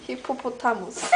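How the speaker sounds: soft Korean-accented hippopotamus with aspirated p and t; hushed demo of hippopotamus in a Korean accent. Shown as a demonstration. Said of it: "Korean accent".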